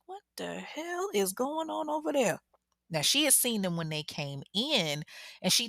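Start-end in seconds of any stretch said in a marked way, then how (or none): 0.1-2.4 high startled call; shocked neighbor calling out; alarmed neighbor. Retold or imitated. The neighbor seeing the girls run, alarmed. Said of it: "she's like".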